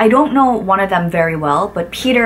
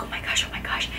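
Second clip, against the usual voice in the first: breathless whispered panic; panicked whisper; 13 dB quieter, faster than usual. Retold or imitated. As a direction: whisper it in silent alarm. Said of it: "this face on, like".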